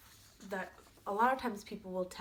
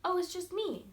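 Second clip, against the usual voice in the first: high little billy goat, louder; wee goat voice; 11 semitones higher, 6 dB louder than usual. Acted. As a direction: pipe up like a tiny, innocent goat. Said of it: "in a wee voice".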